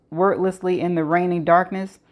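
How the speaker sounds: ordinary delivery, nothing marked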